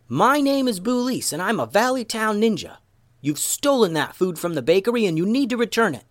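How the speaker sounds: high-pitched challenge, little extra volume; calling out. A narrator's reading. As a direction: call it out high as a bold challenge. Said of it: "Boulise yelled out".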